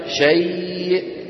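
slow chanted شيء; pausal form. Shown as a demonstration. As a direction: hold شيء, then stop. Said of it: "كيف نقف عليها".

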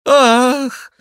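high, loud wail; theatrical high-pitched lament; despairing cry. A narrator's reading. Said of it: "с отчаянием вскричал Шико".

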